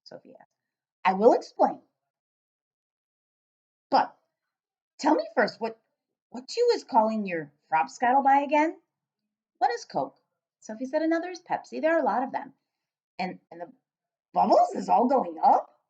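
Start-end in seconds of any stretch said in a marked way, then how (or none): 1.0-8.8 a hint of the giant, incredulous; lightly voiced giant. Giant astonished at the girl, lightly voiced. 9.6-12.5 put-on higher, curious young girl; little girl voice. Sophie asking the giant, light girl voice. 13.2-15.8 a hint of the giant, incredulous; lightly voiced giant. Giant astonished at the girl, lightly voiced.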